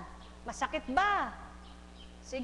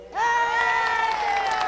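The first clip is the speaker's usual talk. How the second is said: loud urging; 7 semitones higher, 7 dB louder, much slower than usual. Real, not acted. Urging patient to answer.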